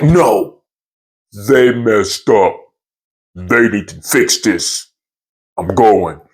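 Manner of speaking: slow, low, deliberate mock anger; retold grim determination; heated resolve. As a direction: lay it down slowly and firmly. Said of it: "Oh, I'm getting heated".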